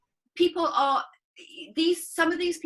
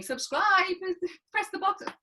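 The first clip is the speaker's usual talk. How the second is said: higher-pitched mock YouTuber call; imitating YouTubers; 9 semitones higher, 4 dB louder than usual. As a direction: mimic a pushy YouTuber plug. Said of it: "they're always going".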